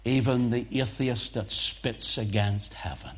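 ordinary talk, nothing marked

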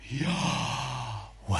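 Yahweh as a soft breath, falling away; the name sounded like breathing; breathy Yahweh. Shown as a demonstration. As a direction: let Yahweh out like an exhale. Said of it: "His name is breath".

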